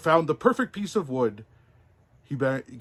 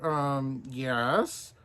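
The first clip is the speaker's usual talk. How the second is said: lying. Acted. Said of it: "lied Pinocchio".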